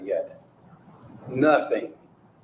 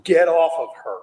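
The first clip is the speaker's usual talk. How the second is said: "Get off of her" thrown out loud and higher; retold shout to get off her; quoted shout; 6 semitones higher, 6 dB louder than usual. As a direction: shout it like pulling someone off. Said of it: "you hear Jimmy".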